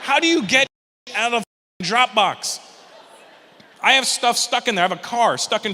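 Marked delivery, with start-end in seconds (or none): 0.0-2.6 frustrated shout. Retold or imitated. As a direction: bellow the question in despair. Ranting about Dropbox.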